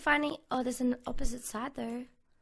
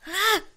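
loud, high short yelp; startled yelp; 7 semitones higher, 9 dB louder than usual. Real, not acted. Gamer surprised by the snake again, loud startled yelp. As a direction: yelp at the sudden scare.

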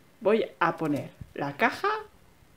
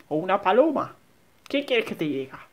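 ordinary talk, nothing marked

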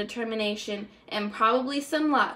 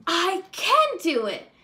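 slow, higher self-encouragement; saying it aloud; 6 semitones higher, 3 dB louder than usual. A narrator's reading. Stanley in his taxi telling himself he can, slow and higher. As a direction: talk yourself into it, slowly. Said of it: "Stanley said aloud one day".

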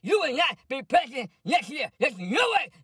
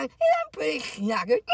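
tipsy slur; 2 semitones higher than usual. Pretend. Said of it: "I was drinking wine".

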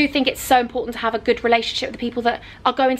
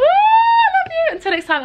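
excited; 6 dB louder than usual. Real, not acted. Calling out goodbye to viewers.

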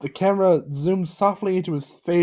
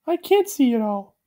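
high voice; 11 semitones higher than usual. A narrator's reading. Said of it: "he said".

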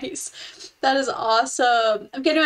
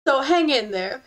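ordinary talk

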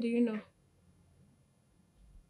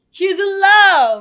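loud, high, drawn-out tease; teasing exclamation; 9 semitones higher, 17 dB louder, slower than usual. Acted. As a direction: sing out the gossip loudly and slowly. Teasing a friend about a crush.

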